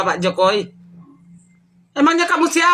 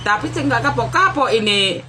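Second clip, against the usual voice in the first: ordinary delivery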